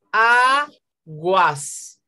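AGUAS as a long A, pause, GUAS; two separate syllables. Shown as a demonstration. Teacher showing the class a diphthong, two separate syllables. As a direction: break aguas into just A - GUAS. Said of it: "Solo hay dos sílabas".

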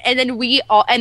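ordinary talk, nothing marked

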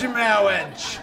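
comic clergyman's mangled "mawwage", falling away; mock-solemn minister with a silly speech impediment; Princess Bride minister. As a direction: intone it like a pompous officiant. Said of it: "we snuck a Princess Bride reference".

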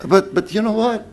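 ordinary talk

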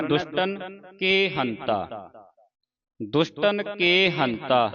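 deliberate, softened teaching recitation; slow model recitation. Shown as a demonstration. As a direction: draw out the verse word by word.

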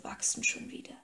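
soft, unvoiced whisper; tender whisper. A narrator's reading. King whispers comfort to the girl stroking her stubble, softly. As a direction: breathe it gently as comfort.

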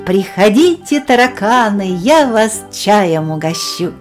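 ordinary delivery, nothing marked

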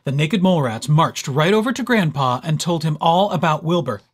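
ordinary delivery, nothing marked

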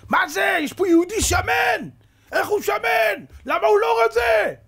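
high, loud officer's roar; outraged shouting. Retold or imitated. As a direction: yell it in sustained, high-pitched outrage. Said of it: "צועק, צועק".

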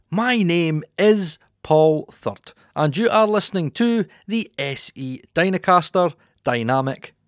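measured broadcast-style mic test read; voiceover demo read. Shown as a demonstration. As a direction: read it like a polished radio voiceover. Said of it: "here is an example".

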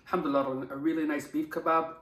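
chewing while talking; speaking between bites; mouth full of food. Real, not acted. Seen 2: Holds bitten kebab piece; lips pressed, mouth working food while speaking.

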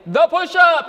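projected military-style command; drill call. Shown as a demonstration. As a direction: announce it like calling out exercises. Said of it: "I would say".

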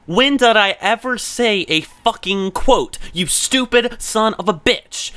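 high, slow, outraged shouting; furious yell. Real, not acted. Yelling at the chatbot that called it a quote, enraged. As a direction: bellow at the bot that misquoted you.

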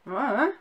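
"Uh," grunted with the mouth barely open, meaning I don't know; Suffolk grunt. Shown as a demonstration. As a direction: say "Uh," as one short lazy closed-mouth grunt. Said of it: "So for example".

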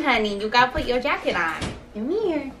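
ordinary talk, nothing marked

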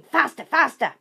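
loud, high frantic urging; shouting. A narrator's reading. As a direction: drive the men on madly.

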